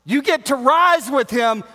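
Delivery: loud, high, shouted sermon; fiery preacher at full volume; full-force preaching. Real, not acted. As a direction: preach it hard to the back row.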